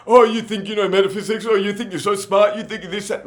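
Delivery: high, sneering, mocking voices; mocking taunt. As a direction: taunt him like a know-it-all bully. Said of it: "totally humiliating me".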